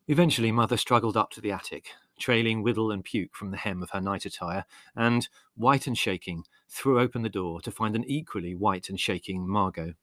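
ordinary delivery

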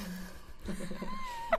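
squealing laugh; high burst of laughter. Real, not acted. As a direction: break into a shrieking laugh. Laughing at fart question.

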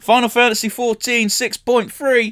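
high, drawn-out announcement; topic announcement. Real, not acted. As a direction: announce the next topic big and slow.

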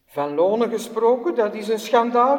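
loud, indignant workman shouting; angry shout. Acted. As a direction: yell it out like a furious striker. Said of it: "riep de man".

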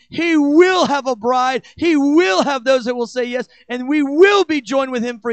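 preaching at full force. Real, not acted.

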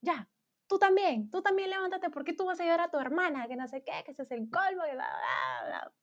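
high-pitched nagging mother, no real shout; mock yelled scolding. Mother scolds son on the couch, mock yelling. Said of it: "mi vieja le gritó a mi hermano".

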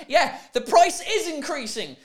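high, drawn-out, just after laughing; amused exclamation. Real, not acted. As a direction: exclaim it through real amusement. Laughing at the mistranslation.

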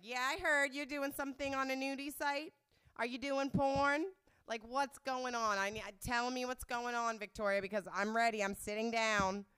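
high, loud imitation of a worried mother; interrogating mom. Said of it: "she's like".

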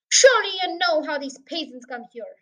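ordinary delivery

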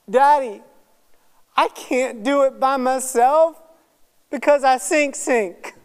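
high, loud mock-tearful little boy; imitated crying child. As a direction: imitate a child blubbering to his dad. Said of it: "and he's crying".

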